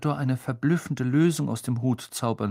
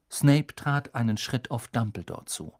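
usual speaking voice

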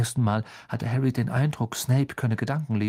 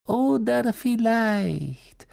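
slow, cold, much higher; drawn-out icy menace; 10 semitones higher, much slower than usual. Acted. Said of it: "sagte eine eisige Stimme".

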